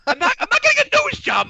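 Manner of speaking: very high, squeaky mock outrage; indignant protest. Answering people who assume surgery, indignant. Acts indignant denial of nose job.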